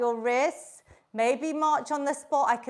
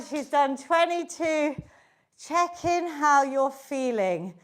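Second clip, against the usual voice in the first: normal talking voice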